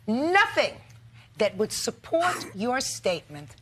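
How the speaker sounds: high, slow indignant list, each item driven home; scolding emphasis. Real, not acted. Judge rebuking a businessman who brought no proof, indignant. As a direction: hammer each missing item with a high, drawn-out attack.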